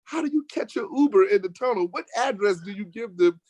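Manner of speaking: disbelieving every word she says; exasperated retort. Retold or imitated.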